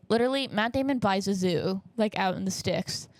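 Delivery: normal talking voice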